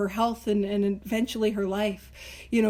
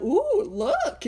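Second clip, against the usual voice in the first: high, hammed-up admiration; impressed buyer; 10 semitones higher than usual. Buyer admiring the heavy book, mock-impressed. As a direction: ooh and aah over it, pitched up. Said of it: "So it would be like".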